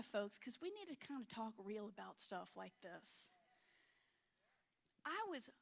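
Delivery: ordinary talk, nothing marked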